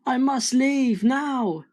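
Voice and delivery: put-on high, slow frightened boy; trembling Jack. A narrator's reading. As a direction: think it aloud in fear. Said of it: "Jack trembled and shook".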